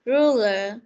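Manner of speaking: Ruler said loudly with a firm English r; model pronunciation. Shown as a demonstration. Teacher drills Ruler loudly for the class. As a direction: say Ruler the way the pupils should. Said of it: "Việt nào?".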